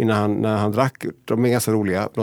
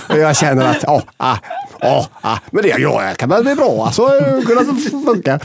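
hammed-up sheepish friend, climbing higher and louder; imitating Marcus Birro; 5 semitones higher, 6 dB louder than usual. Friend caught out on the phone, mock-defending his stunt, high and loud. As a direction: squeak out the lame excuse, voice rising to the top.

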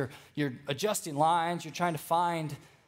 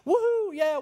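high, louder whoop; mock celebration; 14 semitones higher, 5 dB louder than usual. Preacher voicing celebrating disciples, a high loud whoop. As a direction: cheer like a fan at a party.

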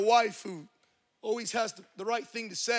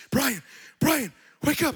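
urgent wake-up call; 2 semitones higher, 6 dB louder than usual. Retold or imitated. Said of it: "I'm awakened on the air mattress".

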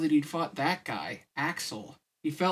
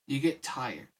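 plain reading voice

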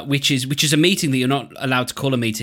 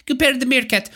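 comic Russian accent, pitched up; higher-pitched mock Russian accent; 7 semitones higher than usual. Shown as a demonstration. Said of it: "or with a Russian accent".

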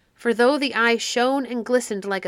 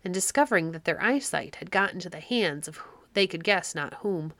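ordinary delivery, nothing marked

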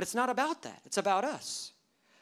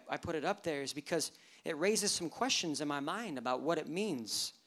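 ordinary talk

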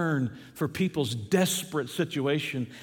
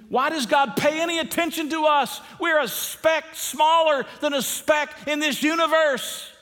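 high, shouted pulpit wonder; impassioned questioning; 9 semitones higher than usual. Real, not acted. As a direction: cry it out at full pitch. Preacher cries out in amazement.

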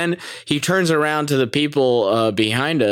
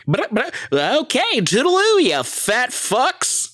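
much higher, stammering cartoon pig voice; Porky the Pig sign-off; 12 semitones higher, slower than usual. Cartoon pig signing off the show, stammering and high. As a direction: stutter then chirp the goodbye. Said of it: "what if Porky the Pig signed off like that".

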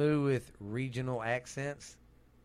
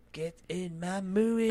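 put-on high, slow Scottish accent; drawn-out Scottish brute demanding food; Fat Bastard; 6 semitones higher, slower than usual. Doing movie cows, slow high Scottish impression. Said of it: "fat bastard would be like".

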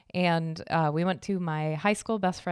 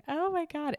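high, overwhelmed gasp; welling up at the sight; retold teary oh my god; emotional oh my god; 10 semitones higher, 3 dB quieter than usual. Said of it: "I started crying because I was like".

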